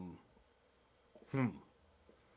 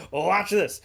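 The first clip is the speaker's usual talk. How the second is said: louder, higher cheerful boast; showing off; 7 semitones higher, 9 dB louder than usual. Acted. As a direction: cook eager to show his skill. Cook about to show off.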